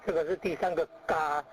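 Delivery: ordinary delivery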